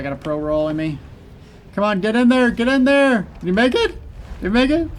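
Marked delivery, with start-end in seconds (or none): 1.7-4.9 high, loud tense cheering; excited urging. Real, not acted. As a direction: urge them on, pitch rising. Tense moment in boss fight.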